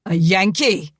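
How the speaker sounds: loud appalled "A Yankee?"; frenzied outburst. Acted. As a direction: spit out "A Yankee?" in outraged disbelief. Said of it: "Angus's frenzy was renewed".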